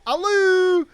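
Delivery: high mimicked hello with an "oo" ending; high-pitched "a-loo", playfully mocking; cheeky echo. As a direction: echo the greeting back in a high, silly call. He cheekily echoes cop's hello.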